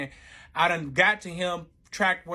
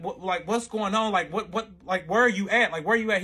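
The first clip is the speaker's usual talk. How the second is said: higher-pitched, mildly frantic retelling; retold distraught questioning; 4 semitones higher, 5 dB louder than usual. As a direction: re-enact urgent, repeated questioning. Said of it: "I'm in distraught".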